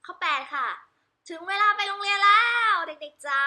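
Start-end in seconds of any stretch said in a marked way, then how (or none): 1.3-3.4 put-on calling the children. Parent calls children to school, loud high call. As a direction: call out to the kids across the house.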